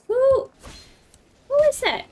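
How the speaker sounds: high startled question; who's that, jump in surprise; surprised. Real, not acted. Surprised by a newcomer.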